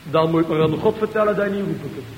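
normal talking voice

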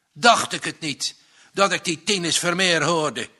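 loud furious farmer bellowing; angry shout. A narrator's reading. Angry farmer shouting at the unwelcome visitor. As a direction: roar it out in a rage. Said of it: "schreeuwde hij".

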